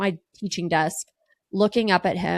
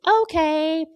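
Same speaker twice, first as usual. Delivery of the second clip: high, drawn-out okay; reluctant high-pitched giving in; 8 semitones higher than usual. Retold or imitated. Wife answering husband who asks to be told, high drawn-out okay. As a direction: give in with a high, held-out word.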